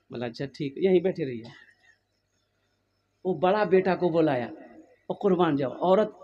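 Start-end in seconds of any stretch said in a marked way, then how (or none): 0.3-1.5 soft, hushed reply; quiet knowing wife. Acted. Wife hushing her husband, softer and knowing. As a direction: say it softly, a plan in mind.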